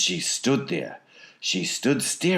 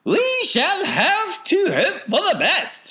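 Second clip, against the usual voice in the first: very high, loud falsetto; Wonka's piping voice; 7 dB louder than usual. A narrator's reading. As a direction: offer hope in an eccentric falsetto. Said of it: "he said".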